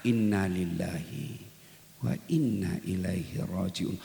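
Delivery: hushed, low and unhurried, hand on chest; quiet drawn-out dhikr; slow and soft. Shown as a demonstration. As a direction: let each word sink in slowly. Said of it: "jangan cepat ketika ngucapnya".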